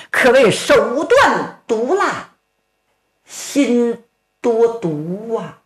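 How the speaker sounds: slow, heavy and bitter; slow bitter musing. Acted. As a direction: drag out the words in grim anger. Ruan Ying brooding over the poisoner.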